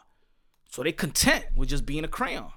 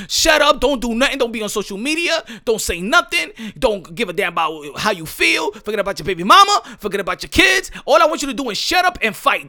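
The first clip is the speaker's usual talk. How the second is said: loud, high, hectoring commands; mock bossy orders; 4 semitones higher, 7 dB louder than usual. Voicing fans ordering Ryan Garcia around, loud and bossy. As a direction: rattle off orders as the demanding fans. Voicing fans' demands on the boxer.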